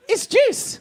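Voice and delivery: very high, squeaky protest; high, hammed-up exasperation; baffled insistence. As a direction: squeak it in disbelief. Baffled boyfriend insists it is juice.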